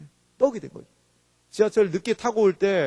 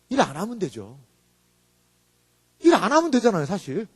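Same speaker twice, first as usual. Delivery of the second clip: ordinary talk, nothing marked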